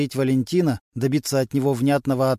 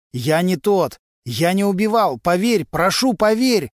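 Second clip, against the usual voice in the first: loud, higher begging, slowing at the end; desperate pleading shout; 6 semitones higher, 5 dB louder than usual. A narrator's reading. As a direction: beg at the top of your voice. Prisoner begs as he is led away. (громкая отчаянная мольба)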